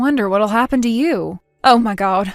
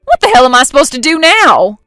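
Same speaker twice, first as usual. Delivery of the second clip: louder, frantic cry; panicked outburst; 3 semitones higher, 6 dB louder than usual. Acted. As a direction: panic as the wife bangs on the door. Panic at wife banging door.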